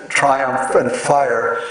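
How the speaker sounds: "triumph" and "fire" slow, long I drawn out; long I's stressed. Shown as a demonstration. Lecturer showing assonance of long I, slow and drawn out. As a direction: stretch the long I in both words. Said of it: "these have to be given their full force".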